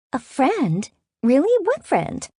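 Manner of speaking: high-pitched, disbelieving sister; incredulous questioning. A narrator's reading. Sister grilling her about a friend, incredulous. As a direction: pounce on it with rising curiosity.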